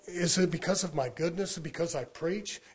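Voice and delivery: ordinary delivery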